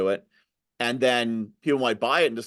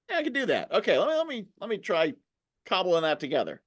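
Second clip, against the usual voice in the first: encouraged viewer; 7 semitones higher than usual. Retold or imitated. Voices a webinar viewer's reaction.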